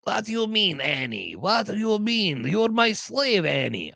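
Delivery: mock the Star Wars junk dealer; Phantom Menace slave owner voice. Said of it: "The one that talks like this".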